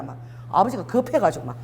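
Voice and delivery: usual speaking voice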